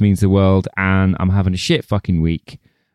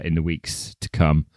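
ordinary talk, nothing marked

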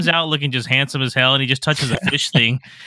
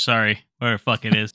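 normal talking voice

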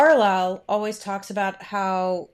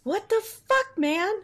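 stunned disbelief; 11 semitones higher than usual. Real, not acted. Disbelief at the book.

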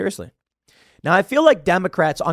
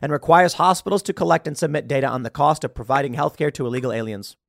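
normal talking voice

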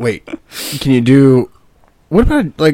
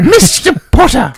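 high, drawn-out call of a stern professor; Professor McGonagall; 13 semitones higher, 4 dB louder than usual. Acted. Teacher calling out a pupil, hammed-up McGonagall. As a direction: call out slowly as a mock teacher. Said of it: "Do it as Professor McGonaghy".

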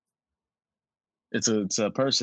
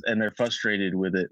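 ordinary talk, nothing marked

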